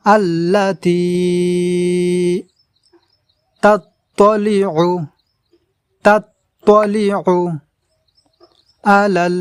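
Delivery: slow chanted phrases repeated for learners; recitation demo. Shown as a demonstration. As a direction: chant each word clearly, one by one. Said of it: "এখানে আমরা টেনে পড়ব এইভাবে". (শেখানোর জন্য ধীরে সুর করে পড়া)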